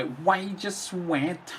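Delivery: very slow, quiet imitation of a Liverpool accent; mock Liverpool accent. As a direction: drag it out like a Scouse opponent moaning. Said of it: "I think that was his accent anyway".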